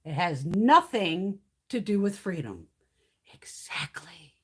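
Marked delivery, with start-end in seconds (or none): 3.3-4.3 soft breathy whisper, slow. Real, not acted. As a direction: whisper it like a private yes. Whispered agreement.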